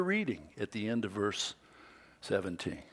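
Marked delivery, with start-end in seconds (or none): none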